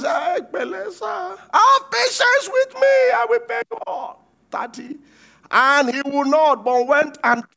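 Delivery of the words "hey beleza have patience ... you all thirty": desperate pleading. Acted. Fellow servant begs the servant for patience, crying high. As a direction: beg him for time, voice cracking up high.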